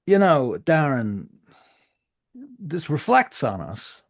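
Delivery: loud, slow deliberate threat; cold gang boss warning. Acted. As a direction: lean on every word. Sex Money warning.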